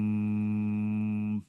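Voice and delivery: soft low hummed purr; quiet low mock purr hum; imitated purr. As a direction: hum like a contented creature purring. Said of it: "almost like he's purring".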